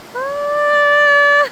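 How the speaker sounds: high, loud startled shriek. Real, not acted. Vlogger alone on a swaying bridge, startled shriek. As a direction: yelp as the bridge sways under you.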